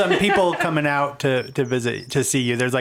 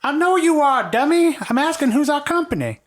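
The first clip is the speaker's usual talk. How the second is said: put-on high, louder teasing old woman; scolding old lady; 13 semitones higher, 3 dB louder than usual. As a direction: scold him fondly, grandma.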